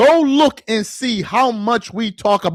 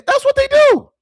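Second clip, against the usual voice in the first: high squeak; 3 dB louder than usual. Real, not acted. Sudden squeak after mock quote.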